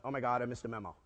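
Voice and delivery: low, soft inner voice; a hint of a TV-star inner voice; Tom Selleck. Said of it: "my little Tom Selleck voice went".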